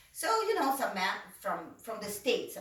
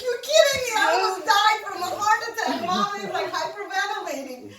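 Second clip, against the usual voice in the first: high, loud relieved outburst; exasperated yell; 10 semitones higher, 10 dB louder than usual. Retold or imitated. Brother learning it was a joke, high loud yell. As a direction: yell it half laughing in relief.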